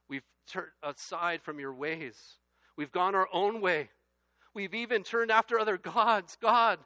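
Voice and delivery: pleading prayer rising to a loud cry on God; impassioned prayer. Acted. Fervent confession prayer read aloud.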